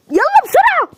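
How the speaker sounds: high, urgent hurry-up; urgent call. Acted. Urging everyone out to the hospital, high and urgent. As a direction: hurry them out, pitched high.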